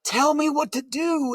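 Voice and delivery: high, slow, desperate plea; drawn-out pleading prayer, pitched well up; crying out to God. Retold or imitated. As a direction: cry out to heaven for an answer. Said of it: "I'm crying out".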